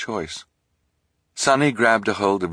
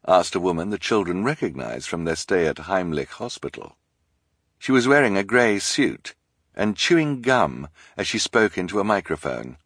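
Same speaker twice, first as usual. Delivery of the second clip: ordinary delivery, nothing marked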